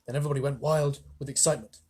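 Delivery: usual speaking voice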